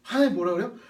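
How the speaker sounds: normal talking voice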